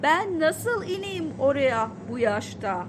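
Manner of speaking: loud, slow shouted repeat; shouting at full voice. A narrator's reading. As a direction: yell it so he finally hears. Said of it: "Sesim çıktığınca bağırarak tekrar söyledim".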